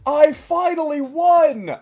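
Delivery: high, loud, drawn-out victory cry; mock villain's gloating yell; triumphant villain. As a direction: shout it slowly in triumph, imitating Zim. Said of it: "and he's like".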